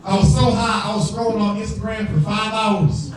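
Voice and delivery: usual speaking voice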